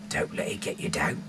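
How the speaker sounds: normal talking voice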